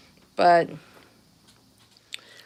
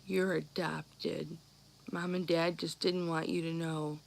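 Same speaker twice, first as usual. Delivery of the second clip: hushed confession; 4 semitones lower, 11 dB quieter than usual. Retold or imitated. Brother tells little sister secret.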